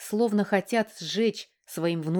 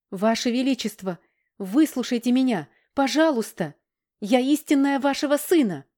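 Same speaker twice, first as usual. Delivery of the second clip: high, loud desperate pleading; pleading shout; 5 semitones higher, 4 dB louder than usual. Acted. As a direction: cry out begging the king. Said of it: "Снова крикнула".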